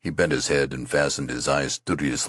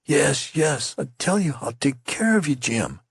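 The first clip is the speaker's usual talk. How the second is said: protesting; 11 semitones higher than usual. A narrator's reading. Youth protests he will care for Jim. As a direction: insist over and over. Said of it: "protested the youth".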